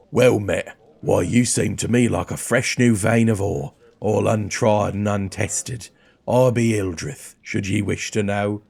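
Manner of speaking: burly dwarf warrior voice; accented dwarf fighter, measured and hearty; Hildreth. Acted. Dwarf greeting a new traveller, put-on accent.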